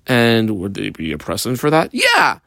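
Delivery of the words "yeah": excited yes. Acted. Excited answer.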